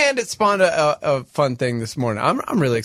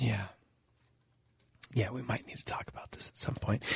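whispering; 6 semitones lower, 16 dB quieter than usual. Real, not acted. Secret HR news aside.

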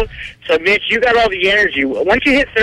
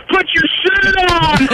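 high, stretched mock yell, half laughing; retold scream; 7 semitones higher than usual. Driver retelling how they screamed at a shirtless kid, mock yell. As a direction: imitate screaming at someone, drawn out and high. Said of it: "and then I screamed".

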